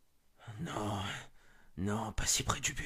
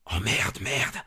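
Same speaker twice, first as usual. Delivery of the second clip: startled swear; 5 semitones lower, 6 dB louder, slower than usual. Acted. Sees a huge figure leave.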